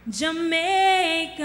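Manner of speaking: loud, high, long-held JAMAICA; rousing cry. Real, not acted. Contestant ending her speech to the crowd, rousing cry. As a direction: sing out the name, stretched and soaring.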